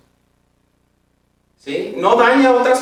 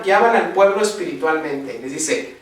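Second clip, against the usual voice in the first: usual speaking voice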